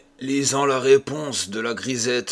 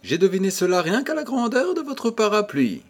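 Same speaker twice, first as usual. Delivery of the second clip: put-on higher, smiling young woman's tease; playful smiling remark, pitched up; 10 semitones higher, faster than usual. A narrator's reading. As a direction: tease him with a smile in the voice. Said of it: "dit-elle en souriant".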